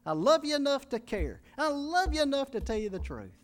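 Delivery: high, loud preaching at full force. Acted. Pastor exhorting the congregation to give their hearts, preaching at full force. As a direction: pour it out to the congregation.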